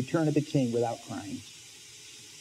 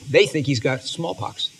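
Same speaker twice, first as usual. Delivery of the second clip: ordinary delivery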